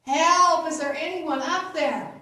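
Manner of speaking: high, loud call upward; acted yell for help. Man hanging from a cliff calls up, desperate yell. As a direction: cry out for rescue, hammed-up. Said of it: "And he yells up".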